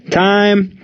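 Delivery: high, held call without much volume; umpire's yell. A narrator's reading. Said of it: "the umpire yelled".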